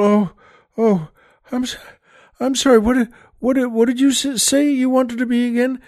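high, groggy, halting nun; dazed nun. Retold or imitated. Nun asking the girl to repeat herself, dazed and high. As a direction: stammer as if just coming round. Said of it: "comes to".

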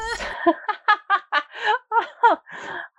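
high, wicked cackle from a caged villain; evil cackle. Acted. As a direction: cackle like a gleeful prisoner mocking them. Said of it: "cackling from inside the bars".

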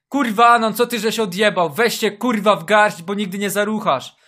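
furious yelling at himself; angry shout. Acted. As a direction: scream it in self-disgust. Yelling at himself after failure.

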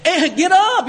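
urgent cheering shout; Adrian yelling. Retold or imitated. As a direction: call him up off the mat. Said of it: "That was my best Adrian".

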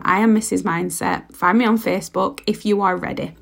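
normal talking voice

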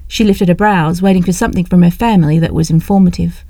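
ordinary delivery, nothing marked

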